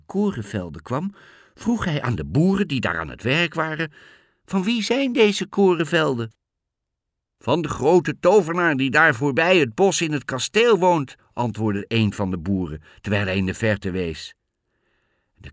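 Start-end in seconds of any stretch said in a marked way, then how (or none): 4.4-6.3 high, loud demanding question; questioning cat. A narrator's reading. 7.4-11.2 high, loud farmer's voice; answering farmer. A narrator's reading.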